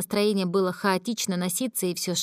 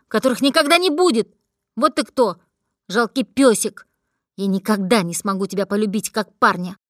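uncontrolled anger; 5 dB louder than usual. Acted. Drunk girl unloads years of resentment on her devoted friend, angry shouting. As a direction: tear into him, louder and louder. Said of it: "неконтролируемая злость".

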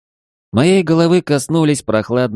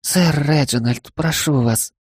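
pleading maid voice; 6 semitones higher, slower than usual. Acted.

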